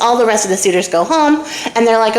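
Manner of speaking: ordinary delivery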